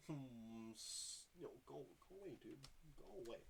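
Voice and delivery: soft, urgent shoo at a bug; quiet shooing. Real, not acted. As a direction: shoo the bug under your breath.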